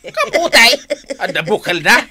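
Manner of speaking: high, loud, laughing questions; laughing disbelief. Acted. Man questioning his wife about the sweet potato, laughing disbelief.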